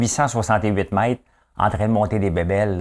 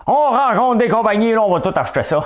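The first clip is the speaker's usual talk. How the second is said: much higher and louder, hammed-up swagger; mock boastful; 13 semitones higher, 6 dB louder than usual. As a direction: play the big shot who'll buy everything. Boastful joking reply to fan.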